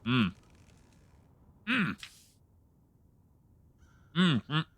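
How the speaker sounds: mumbling with a full mouth. Real, not acted. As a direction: hum through a mouthful of food. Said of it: "My mouth is so full".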